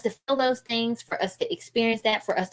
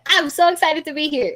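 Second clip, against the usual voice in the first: bright, higher, louder "so excited" greeting; 8 semitones higher, 4 dB louder than usual. Shown as a demonstration. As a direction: sound thrilled to be here. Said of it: "y'all know at the beginning when I was talking".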